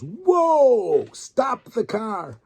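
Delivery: high, loud startled whoa, then drawn-out slow stop the car; stunned tourist shouting to halt the car; amazed outcry. Retold or imitated. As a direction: cry out in amazement, then stretch out the order. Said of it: "and he goes".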